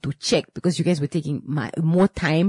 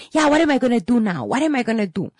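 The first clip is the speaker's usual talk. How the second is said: fast, high imitation of an escalating client; riled-up client; 9 semitones higher, 3 dB louder, faster than usual. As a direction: mimic a client working herself up. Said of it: "She's going up, she's going up".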